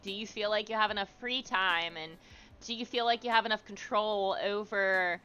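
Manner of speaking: usual speaking voice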